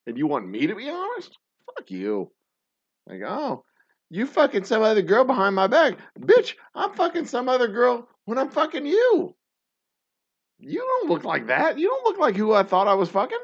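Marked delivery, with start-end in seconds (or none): 3.3-6.0 high, loud whiny mock voice; hammed-up whiny accuser; mocking partner. 6.2-13.4 high, loud put-on voice; hammed-up sassy comeback; mock retort. Ranting retort in voice.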